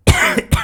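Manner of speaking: sudden harsh cough on spit; choking cough. Real, not acted. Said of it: "sorry, I'm choking".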